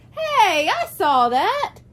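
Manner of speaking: put-on high excited boy's shout; excited yell. A narrator's reading. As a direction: yell it out like an eager boy. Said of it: "Scott yelled out".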